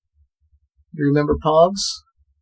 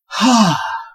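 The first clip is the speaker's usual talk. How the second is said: big breathy exhale after talking; heavy sigh; 7 semitones higher than usual. Real, not acted. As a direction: let out a long winded sigh. Winded after long plug.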